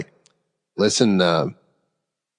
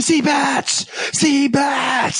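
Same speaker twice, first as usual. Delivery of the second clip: high, frantic shouted alarm; panicked screaming; much slower than usual. Retold or imitated. Shipmate shouting into the phone to the bridge, panicked. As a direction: yell the warning in sheer panic. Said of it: "she's screaming".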